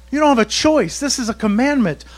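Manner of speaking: ordinary talk, nothing marked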